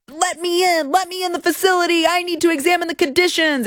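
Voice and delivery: high, whiny mock congresswoman demanding entry; hammed-up impression of an indignant politician. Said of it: "LaMonica McIver was like".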